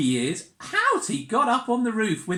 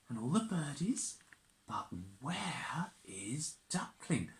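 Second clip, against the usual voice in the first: whisper, full of suspense; 4 semitones lower, 11 dB quieter than usual. Pretend. Asking the child where duckling is, whispered.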